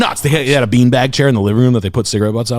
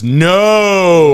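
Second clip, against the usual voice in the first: a high, louder flat no; loud mock refusal; 6 semitones higher, 4 dB louder than usual. Showing friend how he refused the guy, loud high no. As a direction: bark a short no, mock-offended. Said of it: "literally like that".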